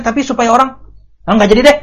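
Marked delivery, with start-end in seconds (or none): 1.2-1.8 offhand giving up. Retold or imitated. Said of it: "Gitu akhirnya kan".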